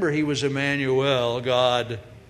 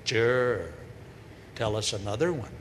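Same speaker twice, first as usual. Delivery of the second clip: lower, dry scoff; sarcastic disbelief; 5 semitones lower, 4 dB quieter than usual. Acted. Townsfolk mocking Mary's story, low dry sarcasm. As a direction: brush her off with a sneer. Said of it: "The Holy Spirit".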